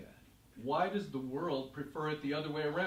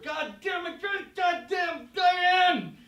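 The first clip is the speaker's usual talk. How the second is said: high, loud, shattering curse at his daughter; father bellowing at daughter after the car skids; furious yell; 10 dB louder, slower than usual. Retold or imitated. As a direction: explode in pain after hitting your head. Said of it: "And he yells at you".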